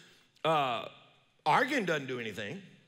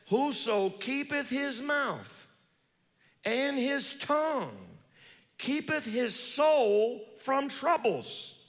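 slow, solemn reading of the verse; slow, reverent scripture read; 9 semitones higher, much slower than usual. Acted. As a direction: lift the old words slowly. Reads Proverbs scripture aloud.

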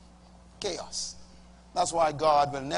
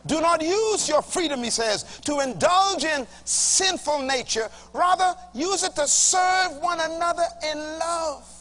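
scripture reading; 11 semitones higher, 4 dB louder than usual. Shown as a demonstration. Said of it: "Read the next verse".

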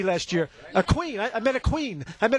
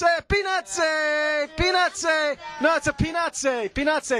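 put-on higher-pitched vendor chant, Italian accent; talking in Italian; 4 semitones higher than usual. Vendor showing how to sell peanuts, chanted in Italian accent. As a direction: hawk it with an Italian "eh" tacked on. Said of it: "you gotta talk in Italian".

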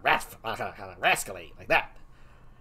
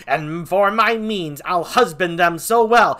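defiant Laertes; 6 semitones higher, 9 dB louder than usual. Acted. Laertes defying the king, loud and defiant. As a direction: plant your feet and refuse.

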